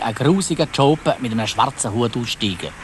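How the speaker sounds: ordinary delivery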